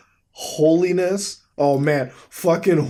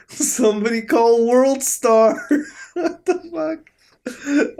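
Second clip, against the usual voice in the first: laughing; 8 semitones higher, much slower than usual. Real, not acted. Laughing at fight photo.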